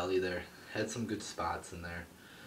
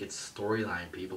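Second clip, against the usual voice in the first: ordinary talk, nothing marked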